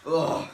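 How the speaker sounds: loud groan after sneezing; post-sneeze groan. Acted. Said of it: "Oh man, that was a powerful one".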